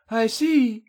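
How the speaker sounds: captive's high-pitched acknowledgement; tense heroine. A narrator's reading. Captive replies, steadying her breathing.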